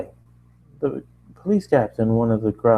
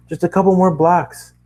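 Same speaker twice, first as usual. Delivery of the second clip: urgent reassurance; 8 semitones higher, 5 dB louder than usual. Acted. Running, reassuring Twilight.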